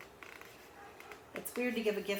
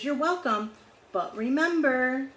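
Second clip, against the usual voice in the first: gently reminding Panda; 5 semitones higher, 6 dB louder than usual. A narrator's reading. Panda replies, higher and louder.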